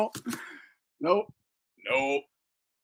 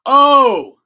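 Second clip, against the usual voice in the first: sudden loud, higher exclamation; surprised shout; 9 semitones higher, 14 dB louder than usual. Real, not acted. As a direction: yelp of surprise. Reacting to video.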